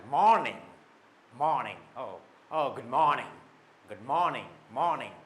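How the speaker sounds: punched first syllable, falling "morning"; stylish morning greeting. Shown as a demonstration. Teacher modelling a stylish morning greeting with a falling end. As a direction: lean on the MOR of "morning", then let it fall. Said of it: "देखिए style".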